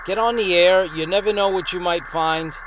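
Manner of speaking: usual speaking voice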